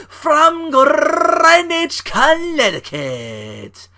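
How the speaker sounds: imitating an over-the-top WWE entrance call; wrestling ring announcer. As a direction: stretch every word like a ring announcer hyping the champ. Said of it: "can you imagine that in a fucking W W E segment".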